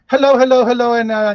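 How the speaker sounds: loud, high cheery greeting; big hello. Real, not acted. Host greeting live viewers, loud cheery call-out. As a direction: call out hello to the whole room.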